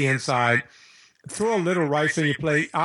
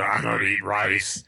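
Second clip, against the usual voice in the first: douchey; 7 semitones lower, slower than usual. Retold or imitated. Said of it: "the douchey move where I go".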